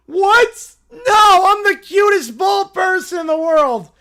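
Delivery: put-on high, loud bratty shouting child; angry whiny kid. Caillou yelling at the goat over his name, bratty shout. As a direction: yell it like a furious little kid.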